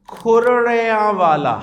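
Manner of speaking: کھرڑیاں والا spelled out slowly, every sound drawn out; slow careful place name. Shown as a demonstration. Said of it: "عرب کو کہیں کہ وہ جگہ".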